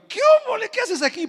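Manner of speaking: very high, loud happy exclamation; surprised greeting. Retold or imitated. Old friend spotting the missionaries, surprised and glad.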